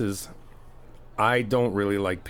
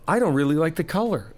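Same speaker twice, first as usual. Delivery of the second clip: higher, louder mock of his past self; quoting himself; 7 semitones higher, 4 dB louder than usual. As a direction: toss off the old complaint in a put-on voice. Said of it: "I went".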